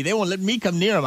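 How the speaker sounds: ordinary talk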